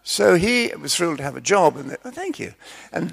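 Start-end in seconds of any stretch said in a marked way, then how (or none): 2.0-2.5 grateful thanks. Acted. Gorilla man thanks manager.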